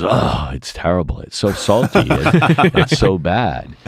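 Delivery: high, pained complaint that cracks into a laugh; imitating the critic's horror at salty roe; mock disgusted food critic. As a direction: whine about the salty roe. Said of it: "he eats it and goes".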